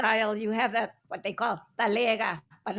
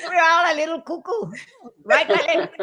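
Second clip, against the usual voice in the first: louder, higher laughing remark; 6 semitones higher, 6 dB louder than usual. Real, not acted. Teasing Valen after the proverb, laughing loudly. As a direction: laugh while you say it.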